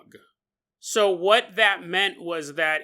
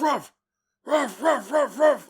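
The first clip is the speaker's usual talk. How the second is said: sharp higher barks; imitating a bark; 6 semitones higher, slower than usual. Shown as a demonstration. Showing how a dog can't bark, mock barks. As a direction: bark it out like a wolf. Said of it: "they can't go ruff".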